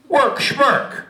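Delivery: high, dismissive little bug; chittering thing. Acted. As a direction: brush it off cheekily. Gregor dismissive.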